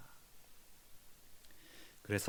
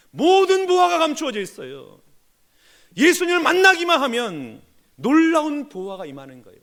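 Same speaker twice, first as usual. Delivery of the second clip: loud, driving sermon voice with hammered peaks; fervent preaching; 9 semitones higher, 8 dB louder than usual. Acted. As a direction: preach it hard to the congregation. Preaching at full force. (힘있게 외치는 설교)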